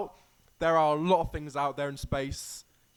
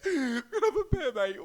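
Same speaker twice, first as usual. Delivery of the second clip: higher, giggling through the words; giggly high-pitched echo; laughing repeat; 8 semitones higher than usual. Real, not acted. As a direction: laugh while echoing the joke. Repeats friend's joke laughing.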